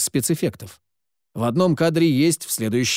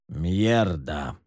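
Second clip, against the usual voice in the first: lower, calm mentor voice; 8 semitones lower than usual. A narrator's reading. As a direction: teach it calmly like a mentor. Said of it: "спокойным менторским тоном сказал Сид".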